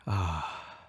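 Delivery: low, long "ah" on the breath; relaxed meditative sigh; contented exhaling sigh. Shown as a demonstration. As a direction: breathe out a restful ah. Sighs out during the meditation.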